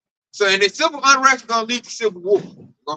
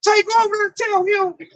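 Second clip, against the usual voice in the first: mocking coworkers; 9 semitones higher than usual. Coworkers egging Tay on.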